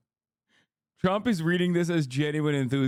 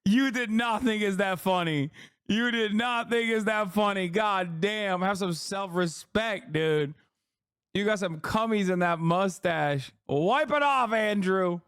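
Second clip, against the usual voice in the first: higher-pitched, incredulous telling-off; exasperated scolding; 6 semitones higher than usual. Real, not acted. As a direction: scold a fawning pal in raised pitch. Scolds the host for fawning.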